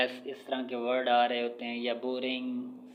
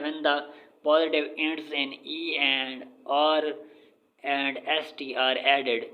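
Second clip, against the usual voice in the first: slow dictation; much slower than usual. Shown as a demonstration. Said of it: "reading English rule aloud".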